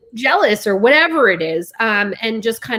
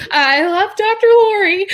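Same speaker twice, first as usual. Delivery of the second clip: getting emotional; 10 semitones higher, 5 dB louder than usual. Real, not acted. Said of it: "I'm gonna get emotional".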